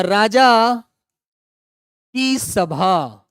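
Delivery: राजा stressed, then की सभा stretched out very slowly; slow dictation. Shown as a demonstration. Teacher breaking Rajya Sabha apart for pupils, very slow. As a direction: draw the split out word by word for the class. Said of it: "इसको तोड़ दीजिए".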